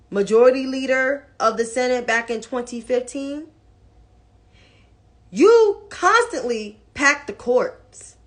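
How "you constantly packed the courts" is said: ordinary talk, nothing marked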